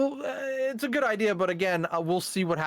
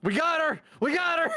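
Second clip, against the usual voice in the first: high, loud victory cheer; triumphant shout; 4 dB louder than usual. Real, not acted. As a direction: yell it like you just won.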